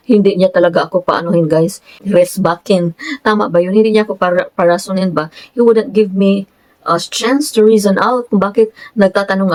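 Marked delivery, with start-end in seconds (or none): none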